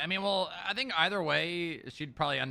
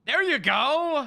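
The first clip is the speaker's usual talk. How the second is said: excited praise; 12 semitones higher, 8 dB louder, slower than usual. Real, not acted. Praising a successful attempt.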